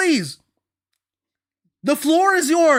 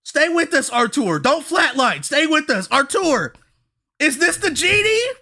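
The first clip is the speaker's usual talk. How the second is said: ordinary delivery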